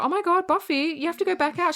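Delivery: high-pitched backstage urging; mock urgency. Someone sending Buffy back on stage, mock urgent.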